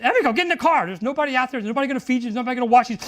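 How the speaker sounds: tearful teacher begging a student to get in the car, loud; pleading shout. Retold or imitated. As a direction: beg her to stop, close to tears. And I start crying.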